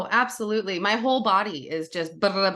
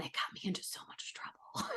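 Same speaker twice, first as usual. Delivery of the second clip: hushed admission; 17 dB quieter than usual. Real, not acted. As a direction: drop to a near-whisper. Mock-confiding admission of childhood trouble.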